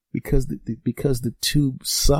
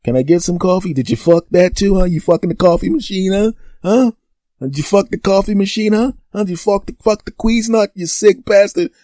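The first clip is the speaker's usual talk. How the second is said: higher and louder playful interrogation; hammed-up teasing accusation; mock accusing tease; 7 semitones higher, 5 dB louder than usual. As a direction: needle your buddy with fake outrage. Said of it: "I was like".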